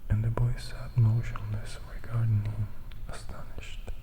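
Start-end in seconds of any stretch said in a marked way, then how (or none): none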